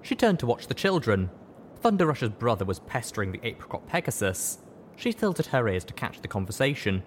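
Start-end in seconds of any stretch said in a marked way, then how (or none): none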